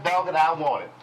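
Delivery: ordinary talk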